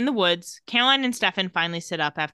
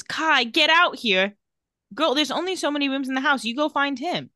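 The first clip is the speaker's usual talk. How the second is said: calling out; 6 semitones higher than usual. Retold or imitated. Voicing Liv calling for Kai.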